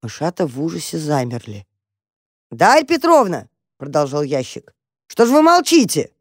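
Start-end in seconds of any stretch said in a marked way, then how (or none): 2.5-3.5 loud, raised insistent call; man's phone voice. A narrator's reading. Man in the box calls again, loud and insistent. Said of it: "сказал басовитым голосом какого-то дядьки". 5.1-6.1 loud, high-pitched impatient demand; man's phone voice. A narrator's reading. Man in the box meets silence, demanding loudly. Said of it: "сказал басовитым голосом какого-то дядьки".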